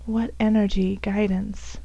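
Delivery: ordinary talk, nothing marked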